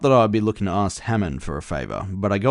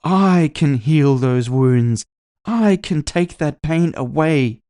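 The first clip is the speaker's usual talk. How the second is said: slow, loud, higher orator voice; messianic promise; 7 semitones higher, 6 dB louder, slower than usual. Acted. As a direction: promise salvation, every word weighted. Politician's rally climax.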